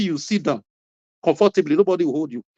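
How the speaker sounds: ordinary talk, nothing marked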